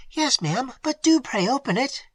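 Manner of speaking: high, begging servant voice; pleading maid. A narrator's reading. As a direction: plead with her mistress. Maid begs at door.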